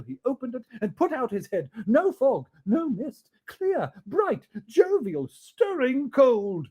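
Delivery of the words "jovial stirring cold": plain reading voice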